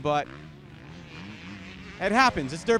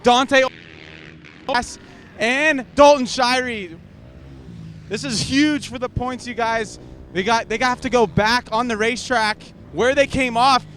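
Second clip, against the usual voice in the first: loud, urgent pit report; breathless sideline reporter; 4 semitones higher, 6 dB louder than usual. Real, not acted. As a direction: shout the update over the pit noise. Pit reporter with breaking update.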